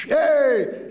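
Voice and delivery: loud, high-pitched yell; big high "hey" shout; calling out. Retold or imitated. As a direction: holler it at someone across the street. Yelling at girls from cop car.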